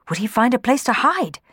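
usual speaking voice

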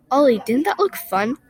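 ordinary delivery

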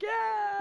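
high mock crowd "yeah"; mock cheer. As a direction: cheer like an applause track. Imitating a cheering applause track.

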